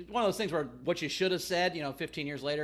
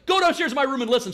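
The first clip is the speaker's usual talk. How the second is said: louder and fast, urgent; retold urgent command; 7 semitones higher, 8 dB louder, faster than usual. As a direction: bark it out fast like a panicked teen. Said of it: "I'm like".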